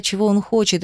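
ordinary delivery

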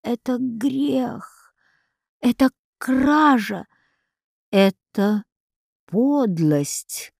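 each word weighed, very slow; slow and deliberate. A narrator's reading. Август, condemning his father's sale, very slow and heavy. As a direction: spell out the accusation slowly. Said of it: "медленно сказал он наконец".

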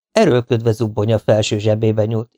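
usual speaking voice

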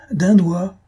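loud, high summoning call; cried out. A narrator's reading. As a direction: shout for the servant through the door. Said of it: "cria-t-elle". (appel crié, fort et aigu)

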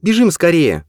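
high, urgent blurt. A narrator's reading. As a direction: burst out in alarm. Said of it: "выпалила".

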